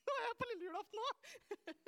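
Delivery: high, loud, giggly aside; real laughing. Real, not acted. Preacher amused at slipping into Christmas Eve talk, laughing. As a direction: crack up at your own muddle. Laughing at losing her thread.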